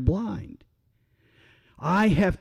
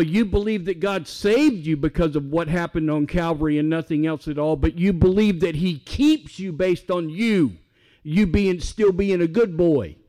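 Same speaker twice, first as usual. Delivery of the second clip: ordinary talk, nothing marked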